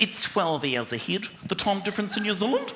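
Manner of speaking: lightly put-on Kiwi stewardess; New Zealand accent. Stewardess answering passenger in a light New Zealand accent. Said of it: "She went".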